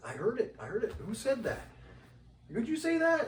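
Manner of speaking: pressing them urgently; retold eager insistence; insistent excitement. Insisting he heard something.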